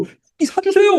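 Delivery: put-on high, whining child's plea; childish whine begging for meat; whiny begging. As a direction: beg for meat like a sulky kid.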